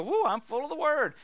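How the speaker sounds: high whooping mock excitement; mock enthusiasm. Preacher mimics believers full of the word, mock enthusiasm. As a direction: whoop it up, making fun of hollow zeal. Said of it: "It's not just to go".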